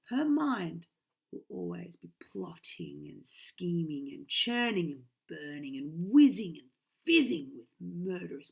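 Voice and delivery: usual speaking voice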